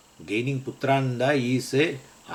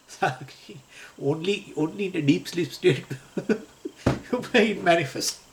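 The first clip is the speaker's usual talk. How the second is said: laughing; 6 semitones higher than usual. Real, not acted. Laughing aside on the sakshi.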